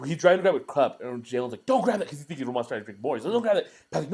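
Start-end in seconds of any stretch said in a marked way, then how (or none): 1.7-2.1 high-pitched quoted warning; urgent warning. Retold or imitated. Friend warning in retold scene. 3.3-3.7 high-pitched quoted warning; urgent warning. Retold or imitated. Friend warning repeated.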